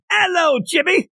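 put-on high Irish-accented [name] greeting; [name] impression. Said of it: "That's [name]".

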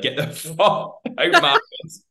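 put-on high, loud, slow drunken brush-off with a laugh; drunk and rude. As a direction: shove him off, drunk and laughing. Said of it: "when I say steaming".

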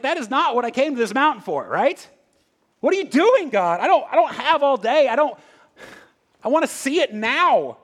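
high, loud, whiny protest; impatient Elijah. Retold or imitated. Elijah complaining to God, high and impatient. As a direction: demand it all right now. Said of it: "It's like".

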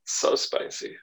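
breathy sexy whisper; sultry narrator. Pretend. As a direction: whisper it seductively. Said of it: "talk in your sexy voice like you just did".

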